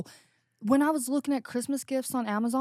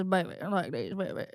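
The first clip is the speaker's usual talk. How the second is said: a hint of a cocky wrestler; 4 semitones lower, 5 dB quieter, faster than usual. Imitating a wrestler's catchphrase to friends, low and soft. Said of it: "talked like".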